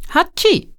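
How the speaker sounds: sudden high sneeze burst; sneezing. Acted. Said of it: "Ich muss ständig niesen".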